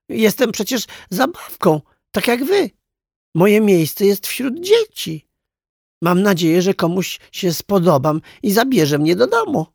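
high-pitched, earnest toy voice; earnest little clown. A narrator's reading. Clown telling the toys why he came, earnest. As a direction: plead your case in a high voice.

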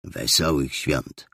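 mocking greeting. Scrooge mocks his nephew's greeting, slow and sneering. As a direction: echo the cheer back with contempt. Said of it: "rzekł Scrooge".

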